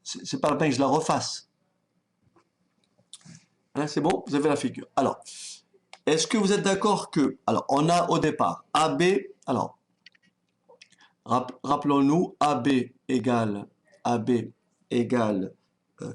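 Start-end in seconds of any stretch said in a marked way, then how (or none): none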